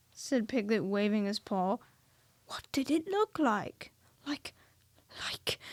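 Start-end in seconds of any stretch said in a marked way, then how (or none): 2.5-5.6 slow unvoiced whisper. A narrator's reading. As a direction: whisper the question slowly. Christopher Robin asking.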